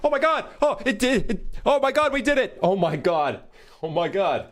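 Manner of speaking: giddy relief. Real, not acted. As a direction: shriek with delight at success. Feature finally works.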